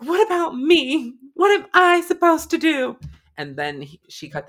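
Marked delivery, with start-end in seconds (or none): 0.0-3.0 high, loud, drawn-out imitation wail; wailed TV quote. Friend retelling a dying-patient scene, mock wailing. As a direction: act out her plea, high and slow. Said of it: "when Denny was dying, and she was like".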